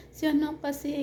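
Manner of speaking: high, tearful sob-voiced plea; choked up between sobs; crying. Crying retelling to neighbor. (umiiyak na pagsasabi)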